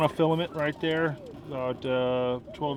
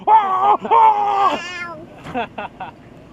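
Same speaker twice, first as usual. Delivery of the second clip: loud playful shriek; 7 dB louder than usual. Real, not acted. Playful noise at child's finger.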